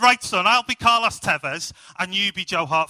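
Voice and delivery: a cheerful Welsh father calling to his son; Welsh dad accent. Retold or imitated. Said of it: "Gavin and Stacey mode".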